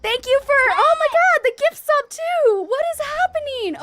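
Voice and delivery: very high, loud, thrilled outburst; overwhelmed excitement. Real, not acted. Streamer stunned by a gift sub, squealing with excitement. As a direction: squeal in happy disbelief.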